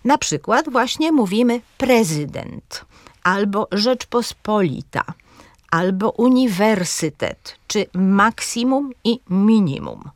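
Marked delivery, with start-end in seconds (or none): 1.8-2.9 „prezydent” z akcentem na PRE; akcent na trzecią sylabę. Shown as a demonstration. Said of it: "takiego akcentu na trzecią sylabę". 3.7-10.1 akcent na PO, WER, MAK, MI w „Rzeczpospolita, uniwersytet, maksimum, minimum”; akcent na trzecią sylabę. Shown as a demonstration. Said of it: "takiego akcentu na trzecią sylabę".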